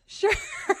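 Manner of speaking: high, real laughter through the words; helpless laughing, pitch shooting up; laughing while talking. Real, not acted. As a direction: crack up while retelling the punchline. Retold husband's reply, cracking up.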